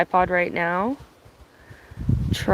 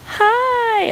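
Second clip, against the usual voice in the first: excited hi; 6 dB louder than usual. Real, not acted. Greeting new viewers on the stream.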